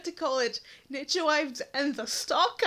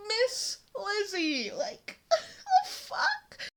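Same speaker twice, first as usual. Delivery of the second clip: high, cracking laughing disbelief; squeaking up in exasperated laughter; 5 semitones higher, much slower than usual. Real, not acted. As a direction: voice breaking mid-laugh. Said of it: "my voice is cracking so bad".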